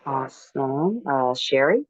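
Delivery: usual speaking voice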